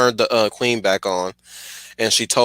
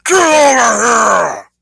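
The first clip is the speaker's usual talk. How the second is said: long, slow, roaring battle cry; Scorpion voice; 13 semitones higher, 9 dB louder, much slower than usual. Acted. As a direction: roar it out, much higher and louder. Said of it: "I'll do it one more time".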